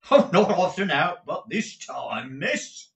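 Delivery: high-pitched cheeky footman, last word swung upward. A narrator's reading. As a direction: insolent servant, slowing into a lilting rise. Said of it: "in a tone which rendered "miss" something more offensive".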